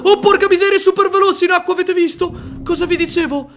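very high, excited exclaiming; thrilled amazement. Real, not acted. Player shows viewers the fast swimming dragon, thrilled. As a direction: burst out loud, marvelling to your viewers.

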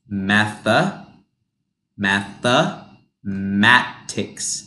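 mathematics, slowly split into math-a-matics with an uh sound; slow teaching demo. Shown as a demonstration. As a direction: spell out mathematics slowly, uh in the middle. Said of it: "this becomes a U H sound".

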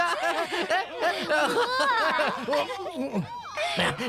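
high leering laugh; lecherous laugh. Acted. Drunk official carousing.

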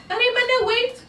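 high, urgent call then a staged sneeze; wife's fake sneeze. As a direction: squeal his name, then fake a sneeze. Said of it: "you say".

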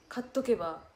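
ordinary talk

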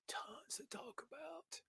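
breathy slow whisper echo; soft whispered repeat. Shown as a demonstration. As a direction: whisper it back slowly as an echo. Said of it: "We got tons to talk about".

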